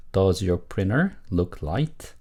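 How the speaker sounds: slow, word-by-word model question; model reading. Shown as a demonstration. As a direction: read it slowly for learners to repeat. Teacher models sentence for repetition. (천천히 또박또박 읽는 시범)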